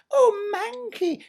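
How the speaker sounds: high, slow syrupy simper; a sickly-sweet imitation of Cinderella; mock Cinderella. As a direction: mimic the sweet stepsister, sugary. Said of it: "she'd say to me".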